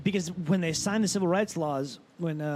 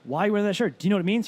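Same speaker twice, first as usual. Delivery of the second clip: louder, insistent question; pressing challenge; 4 semitones higher, 5 dB louder, much faster than usual. Retold or imitated. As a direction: push him for an answer. Skateboarder pressing the comic.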